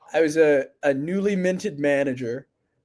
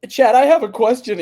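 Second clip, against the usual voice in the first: higher, louder, keen question; lightly voiced eager kid speaking up; eager new rep; 7 semitones higher, 6 dB louder than usual. New rep raising his hand to ask Chad, eager and loud.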